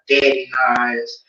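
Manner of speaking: normal talking voice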